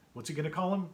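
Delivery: usual speaking voice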